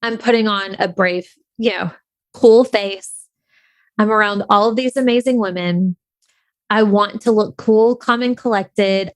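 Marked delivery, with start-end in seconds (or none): none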